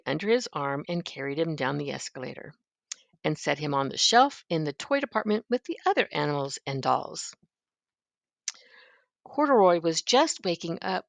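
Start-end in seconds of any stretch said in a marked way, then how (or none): none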